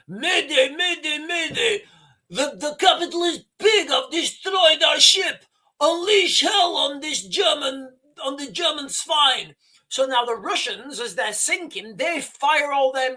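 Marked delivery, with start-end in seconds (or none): 0.0-9.5 high, strained mock-Russian mayday; hammed-up Russian captain raging as the ship sinks; attempted Russian accent. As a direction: shout it high in a rough-and-ready Russian accent. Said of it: "I can't do a Russian accent".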